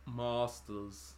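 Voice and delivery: "Masters" with a long "ah" in the first syllable. Real, not acted. Answering what the worlds are called, "Masters" with long A. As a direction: say "Masters" as "MAH-sters". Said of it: "there's no R in it".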